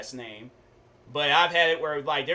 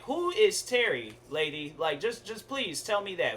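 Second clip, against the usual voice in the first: high-pitched exasperated appeal; exasperated pleading; 5 semitones higher than usual. Retold or imitated. Student to the teacher who misnames him, exasperated. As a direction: plead for an answer, pitch jumping up.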